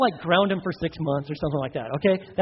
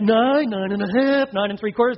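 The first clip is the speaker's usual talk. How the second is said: mock parent reluctantly nearing ten; stalling count; 3 semitones higher, 5 dB louder than usual. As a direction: drag out each number as a warning. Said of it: "And you're like".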